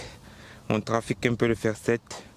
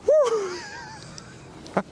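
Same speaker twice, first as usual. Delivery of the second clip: very high whoop; excited whoop. Real, not acted. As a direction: whoop with joy. Chip goes in for birdie.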